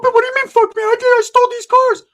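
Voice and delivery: high squeaky falsetto; hammed-up squeaky sidekick; Ricky's voice. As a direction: pitch way up into a goofy falsetto. Ricky voiced in retold story.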